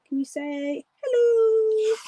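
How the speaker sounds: high, slow sing-song coaxing. Real, not acted. Streamer coaxing her dog to say hello, high sweet baby talk. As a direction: coax the dog sweetly to speak.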